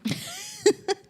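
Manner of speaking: sudden high squeal of a laugh. Real, not acted. Laughing at her scared cohost, high squeal of a laugh.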